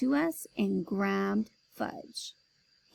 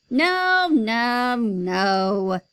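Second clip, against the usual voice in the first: shouting no; 8 dB louder, much slower than usual. Acted. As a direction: bellow it down. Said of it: "he shouted".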